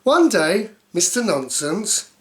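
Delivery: ordinary delivery, nothing marked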